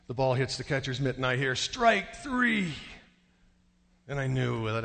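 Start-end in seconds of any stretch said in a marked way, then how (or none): 1.5-2.7 high, drawn-up umpire call; umpire's call. Retold or imitated. Umpire's call in retold game.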